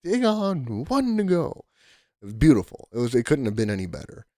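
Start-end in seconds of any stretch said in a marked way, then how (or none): 0.0-1.6 much higher, fuller announcer call; excited play-by-play catchphrase, pitched way up; Hawk Harrelson call. Retold or imitated. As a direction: belt the strikeout call like a broadcaster on air. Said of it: "Hawk Harrelson is his famous".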